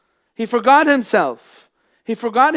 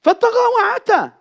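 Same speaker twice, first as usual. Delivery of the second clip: fervent loud recitation; 14 semitones higher, 6 dB louder, much faster than usual. Real, not acted.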